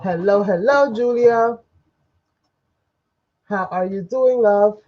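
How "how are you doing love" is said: ordinary talk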